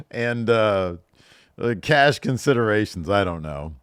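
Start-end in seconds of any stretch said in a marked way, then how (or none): none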